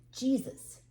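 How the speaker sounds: ordinary delivery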